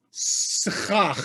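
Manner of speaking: "schach" with a drawn-out s, then throaty "chach"; s before ch. Shown as a demonstration. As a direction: hold the s, then scrape into "chach". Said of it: "it's s first and then".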